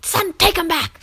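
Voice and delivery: high mock shout; gruff shout. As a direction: bark it like an annoyed dad. Retold angry flower recipient.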